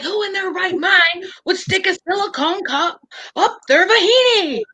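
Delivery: high-pitched incredulous rant; going off. Retold or imitated. Retelling a man's TikTok rant, going off. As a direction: retell it as an outraged, animated tirade. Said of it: "he's, like, going off".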